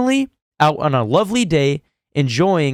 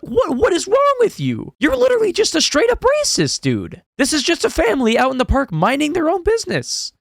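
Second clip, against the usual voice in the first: much higher, indignant demand for answers; outraged; 12 semitones higher than usual. Acted. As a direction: pitch it high like you can't believe him. Outraged address to the ranger.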